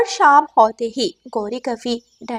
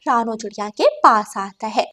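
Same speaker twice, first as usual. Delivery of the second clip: ordinary delivery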